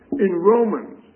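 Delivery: ordinary talk, nothing marked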